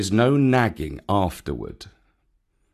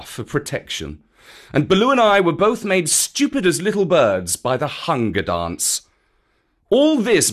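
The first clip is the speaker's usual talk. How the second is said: reproachful panther, emphatic and raised in pitch; Bagheera; 4 semitones higher, 3 dB louder than usual. Acted. Bagheera blames the man cub for the costly rescue, reproachful. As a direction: scold with weighty, emphatic phrases.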